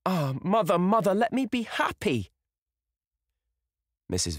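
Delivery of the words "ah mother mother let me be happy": high, louder cry to mother; impassioned plea. A narrator's reading. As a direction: beg to be happy, rising high.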